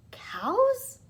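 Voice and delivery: high breathless whispered gasp; startled whisper. Acted. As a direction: caught off guard, a sudden hush. Tom startled by the trick.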